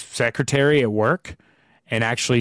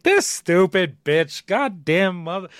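mock angry rant; 6 semitones higher, slower than usual. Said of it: "a fucking clip of me being like".

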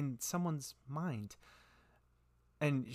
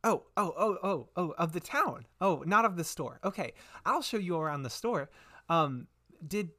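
put-on high, flustered little-girl voice; little girl shopkeeper; 6 semitones higher than usual. Twitch realises he guides the town, high flustered girlish voice. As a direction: work it out aloud as an excitable girl.

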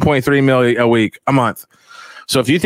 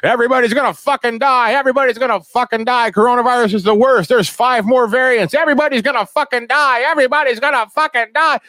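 high, frantic doom-mongering newscaster; hammed-up panic voice of the news; shrill mock alarm, pitched way up; mocking news anchor; 11 semitones higher than usual. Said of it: "they're always like".

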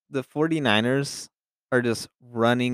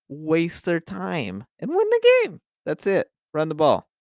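emphatic rising rant; 6 semitones higher, 3 dB louder than usual. Real, not acted. Host hammering home to listeners that teams must run, emphatic and raised.

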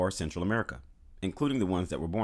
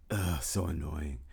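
annoyed groan; 3 semitones lower, slower than usual. Pretend. Mocking America's attitude.

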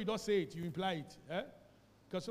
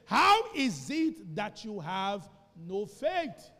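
slow, louder rebuke; slow emphatic quote; 9 dB louder, much slower than usual. Acted. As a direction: weigh each word. Quoting Jesus slowly.

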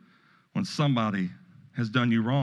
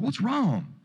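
little grandson voice; 10 semitones higher than usual. Retold or imitated. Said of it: "He's like".

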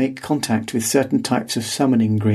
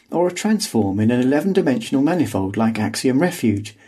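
ordinary delivery, nothing marked

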